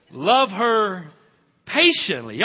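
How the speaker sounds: love her patiently thrown high and loud; loud ringing repeat. Shown as a demonstration. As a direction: call it out to the room.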